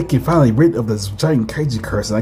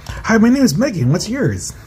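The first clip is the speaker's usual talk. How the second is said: put-on light girl voice; 9 semitones higher than usual. Character Megan introduces herself.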